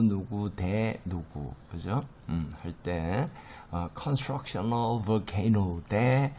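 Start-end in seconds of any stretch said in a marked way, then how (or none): 4.0-5.8 "constructional volcano" slow and forceful, syllable by syllable; emphatic English pronunciation. Shown as a demonstration. Teacher introducing an English term to learners, forcefully stressing "constructional volcano". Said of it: "영어를 발음할 때 좀 강하게".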